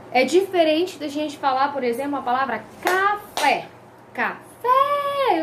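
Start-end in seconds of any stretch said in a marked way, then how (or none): 2.8-3.6 "café" said deliberately, leaning on FÉ; final-syllable stress. Shown as a demonstration. Teacher gives an example word, stress on FÉ in "café". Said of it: "Eu tenho a sílaba tônica onde?". 4.1-5.4 "café" with FÉ high and held long; exaggerated final stress. Shown as a demonstration. Teacher shows where the tonic falls, high stretched FÉ in "café". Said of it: "Na última".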